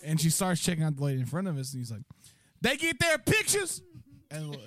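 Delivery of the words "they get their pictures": mock customer question. Voicing customer.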